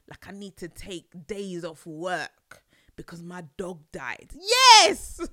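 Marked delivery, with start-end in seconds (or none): 4.3-5.2 loud, high yes; excited shout. Real, not acted. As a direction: cheer it out. Joyful exclamation.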